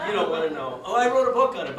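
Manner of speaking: loud laughing aside. Real, not acted. Teller jokes about getting the stone, loud laughter. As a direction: blurt it out through laughter.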